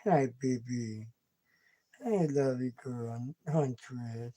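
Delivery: low, soft, slow hello to a kitten; hushed tender coo. Real, not acted. Owner softly greeting her drowsy kitten, low and hushed. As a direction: murmur gently to the sleepy cat.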